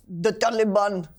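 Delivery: imitated newsreader's Arabic pronunciation; mock Arabic accent. Mocking radio presenters' pronunciation, in an Arabic accent. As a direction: overdo the Arabic accent on each name. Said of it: "with an Arabic accent".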